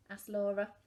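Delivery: ordinary delivery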